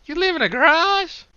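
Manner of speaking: shocked disbelief. Acted. Will shocked that Brian lives in a garage, high startled squeak. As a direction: blurt it out in total disbelief.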